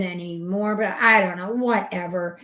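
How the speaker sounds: ordinary talk, nothing marked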